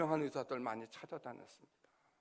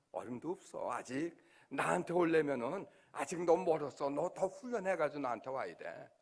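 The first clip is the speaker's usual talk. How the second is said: voice of God; 4 dB louder than usual. Retold or imitated. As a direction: speak as God correcting a proud old pastor. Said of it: "그런 음성이 들려요".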